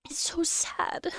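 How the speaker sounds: whispered, slow and broken; real tearful whisper. Real, not acted. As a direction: barely get it out through tears. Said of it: "I'm getting emotional now".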